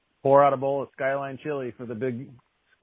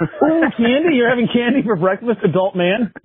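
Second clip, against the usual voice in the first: teasing; 8 semitones higher, 8 dB louder than usual. Real, not acted. Teasing friend about candy breakfast.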